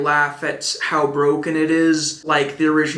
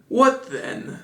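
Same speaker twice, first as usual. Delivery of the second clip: surprised; 8 semitones higher than usual. Acted. Master Cherry puzzled.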